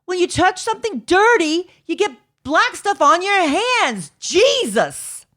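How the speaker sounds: a Brooklyn mom at the end of her rope; exasperated Brooklyn mom. Retold or imitated. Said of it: "some accent that's like a Brooklyn accent".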